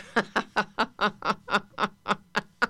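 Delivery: laughter easing down. Shown as a demonstration. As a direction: pull the laugh back a little. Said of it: "And a little softer".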